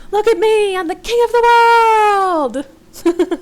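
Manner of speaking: very high, loud, drawn-out triumphant holler; full-out yell. A narrator's reading. Jimmy at the bow of the ship, yelling triumphantly. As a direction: yell it out, stretching the last words long. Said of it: "arms and yell".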